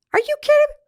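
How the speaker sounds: sudden high-pitched are you kidding; shocked disbelief. Real, not acted. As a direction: blurt it out in real astonishment. Narrator's shocked reaction to outburst.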